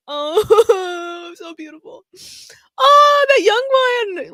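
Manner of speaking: high, drawn-out emotional aww; overwhelmed high coo; touched wailing. Real, not acted. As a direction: wail it as if moved to tears. Moved by tears on screen.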